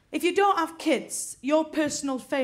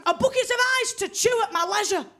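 high, loud shriek across the room; mock scream; 8 semitones higher, 4 dB louder than usual. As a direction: shriek it at the waitress like a toothless diva. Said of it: "she just screamed at her from across the room".

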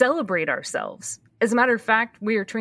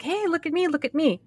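mock attention-seeking; 8 semitones higher than usual. Denying she throws parties for attention, mock attention-seeking. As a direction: play a show-off begging for eyes, lightly.

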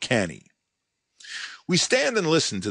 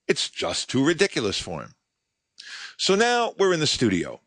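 ordinary talk, nothing marked

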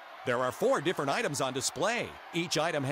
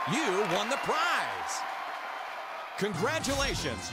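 excited; 3 semitones higher than usual. Acted. Host announces win.

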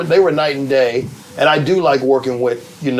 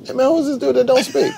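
much higher put-on voice, laughing; imitating Martin; 13 semitones higher than usual. Imitating the star scolding someone who didn't greet him, mock-offended. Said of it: "He'd be like this".

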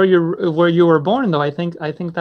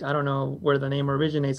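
usual speaking voice

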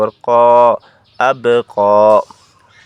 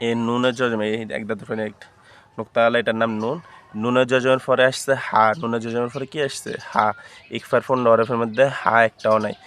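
normal talking voice

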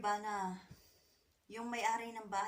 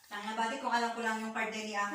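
ordinary talk, nothing marked

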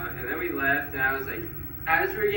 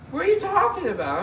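much higher, mock feminine customer-service voice; woman-like guy; 5 dB louder than usual. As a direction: squeak it up like the agent he took for a woman. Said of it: "this guy who I thought was a woman".

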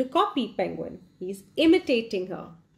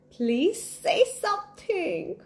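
put-on higher, slow begging child voice; pleading; 9 semitones higher than usual. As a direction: plead with him, drawn out. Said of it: "he got a little more upset and he said".

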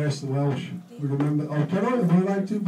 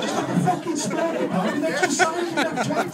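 much higher, laughing through the words; laughing mockery. Real, not acted. Mocking Dougie's kebab order, high laughing exclamation. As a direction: squeal it out laughing at him.

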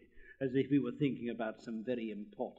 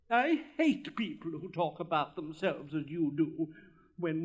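lofty, self-important pronouncement; haughty rocket; 6 semitones higher, 4 dB louder than usual. Acted. Rocket whirling through the air, haughtily insisting.

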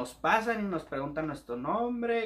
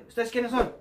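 higher, louder challenge; demanding shout; 8 semitones higher, 6 dB louder than usual. Retold or imitated. Soldier questioning the lost recruits, loud and high. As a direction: call them out sharply.

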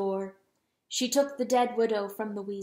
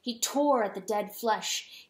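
plain reading voice

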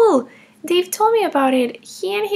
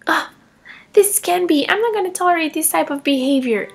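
ordinary talk, nothing marked

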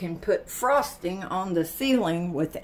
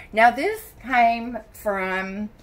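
ordinary talk, nothing marked

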